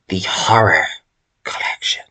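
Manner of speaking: horror fading into an eerie whisper; spooky whisper. Pretend. Vlogger teasing the upcoming horror section, spooky whisper. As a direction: drop to a creepy hush on collection. Announcing horror genre next.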